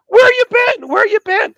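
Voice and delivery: very high, loud mock demand; clamoring coaches. Football coaches greet the lost student, clamoring.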